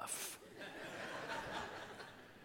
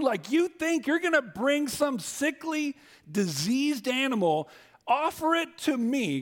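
preaching at full force; 10 semitones higher than usual. Real, not acted. Preacher rebuking the congregation.

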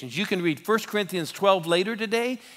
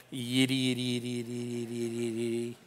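typing noise; 4 semitones lower, 8 dB quieter, faster than usual. Retold or imitated. Mimicking agent typing on keyboard.

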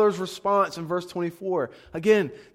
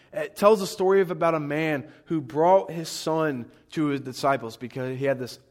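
ordinary talk, nothing marked

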